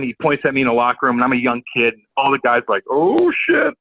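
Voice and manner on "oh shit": high mock gasp of alarm; teammates' shocked reaction. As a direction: sound the alarm for the whole room. Teammates react in locker room.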